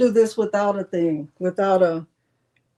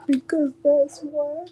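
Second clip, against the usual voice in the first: sassy callback; 6 semitones higher, slower than usual. Retold or imitated. Callback to kid's sassy answer.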